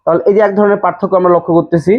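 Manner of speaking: normal talking voice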